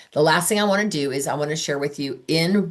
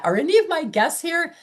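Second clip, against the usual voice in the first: very high, plaintive question; mock anxious searching; 12 semitones higher than usual. Host scrolling the chat for her guests, high mock worry. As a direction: pitch it way up, as if hunting for someone.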